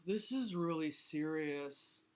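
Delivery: slow, worried groan. A narrator's reading. Walrus despairs at Turtle's tantrum, groaning. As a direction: groan it out slowly. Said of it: "groaned Walrus".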